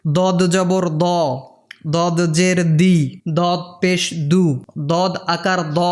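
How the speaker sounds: ordinary talk, nothing marked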